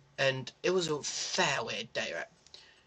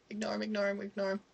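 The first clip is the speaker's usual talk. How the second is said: much higher, softer repeated inner voice; tense inner mantra, higher and softer; 7 semitones higher, 7 dB quieter, faster than usual. Retold or imitated. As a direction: chant it to yourself. Inner voice avoiding tramp.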